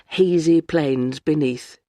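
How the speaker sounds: usual speaking voice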